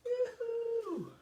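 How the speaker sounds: high sing-out call; a high, drawn-out hail; calling yoo-hoo. Real, not acted. Host hails the online viewers, high sing-out yoo-hoo.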